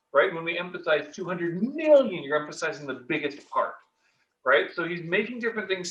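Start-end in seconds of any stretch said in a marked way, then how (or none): none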